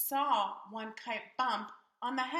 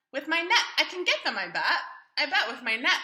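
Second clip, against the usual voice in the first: louder, higher confident boast; boy brags he'll catch them; 4 semitones higher, 6 dB louder than usual. Acted. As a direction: brag about the net.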